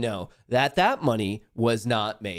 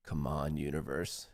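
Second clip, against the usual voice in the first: lower, softer, pleading under his breath; hushed plea; 8 semitones lower, 10 dB quieter than usual. Real, not acted. Streamer begging the universe for his trade, hushed. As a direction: beg the universe quietly.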